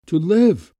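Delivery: to live burst out high and loud; rising cry of longing. Acted. Woman confessing to Gumov, sudden passionate outburst.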